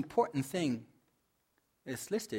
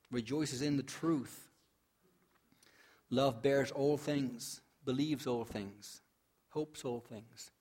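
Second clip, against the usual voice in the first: slow scripture reading; slower than usual. Real, not acted. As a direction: let each line settle, slowly.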